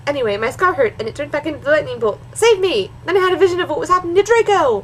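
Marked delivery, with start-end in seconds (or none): 2.3-4.8 high, hammed-up panic; overacted alarm, pitched way up; melodramatic cry. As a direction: rush it out as a mock desperate plea. Mock-dramatic cry in fanfiction reading.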